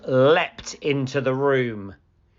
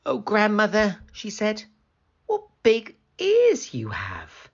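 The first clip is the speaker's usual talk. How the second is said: put-on higher, wondering girl; curious little girl; 10 semitones higher, 3 dB louder than usual. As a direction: marvel innocently. Girl's voice.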